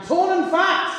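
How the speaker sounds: loud, slow, laughing shout; punchline shout. Acted. Comedian shouting his punchline to the Leicester crowd, laughing.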